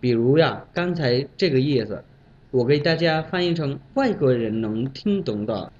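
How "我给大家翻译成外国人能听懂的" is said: normal talking voice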